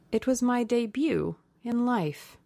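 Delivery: sighed. A narrator's reading. Duchess tells Lord Henry of disillusion, lightly sighing. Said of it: "she sighed".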